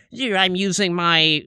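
slow, nasal-free nerdy drone; nerd voice. Retold or imitated. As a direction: play the know-it-all kid. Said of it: "kind of have that, like, nerd voice".

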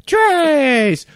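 high, blaring neighbor impression; loud Spanish counting. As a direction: call the number out piercingly, mimicking her. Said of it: "in loud Spanish".

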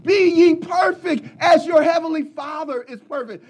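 high, loud, tearful preaching cry; crying shout. Acted. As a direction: wail the verse out at full force. Preaching climax quoting scripture.

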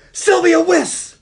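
high, loud, slow shout of her name; delighted shout at the woman; excited call. Retold or imitated. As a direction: call "Sylvia Wiss!" thrilled. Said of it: "Pep is like".

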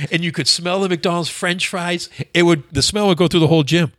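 ordinary delivery

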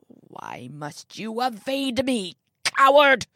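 put-on old man's fury exploding into a shout; raging accusation, high and loud at the end; enraged shout. A narrator's reading. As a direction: start slow, then blast the last word. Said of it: "he shouted at the windows".

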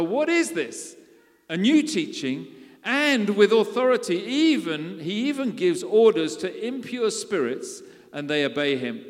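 loud, high astonished exclamation; amazed. Acted. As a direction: exclaim in amazement to each other. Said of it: "The people were also amazed".

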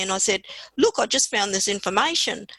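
normal talking voice

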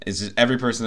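ordinary talk, nothing marked

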